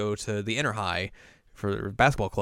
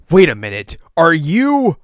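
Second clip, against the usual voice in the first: very loud, high disbelieving question; exaggerated fangirl realization; mock astonishment; 12 semitones higher, 15 dB louder, slower than usual. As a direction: build to a slow, hammed-up squeal. Vice president's astonished realization, acted.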